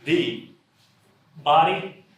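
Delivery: one word per speaker, halting; halting word-by-word delivery; one word at a time. Pretend. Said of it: "They can only speak one word at a time".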